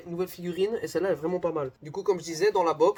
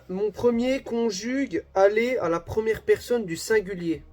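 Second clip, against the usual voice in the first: slow, loud, higher riddle reading; reading aloud; 5 semitones higher, 5 dB louder, slower than usual. Real, not acted. Reading the second riddle to viewers, emphatic read-aloud. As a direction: read out each clue deliberately.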